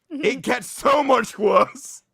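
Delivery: slow, high, loud mock shout; hammed-up shout. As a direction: drag it out like a horror trailer. Dramatic build, shouted.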